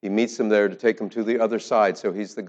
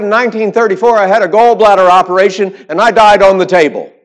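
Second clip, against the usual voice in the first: much higher and louder, full of wonder; excited old woman; 11 semitones higher, 13 dB louder than usual. Retold or imitated. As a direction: tell it like an amazed old lady, high and loud. Old woman retells her death.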